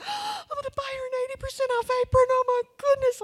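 very high, loud, rushed squeal; gushing excitement. Acted. Shopper overjoyed at eighty percent off, high excited squeal. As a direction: squeal it fast, overcome by the bargain. Acting out thrill at bargain.

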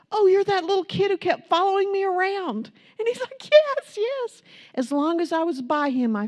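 high, bright retold exclamations; excited reunion. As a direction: relive the joy of recognition.

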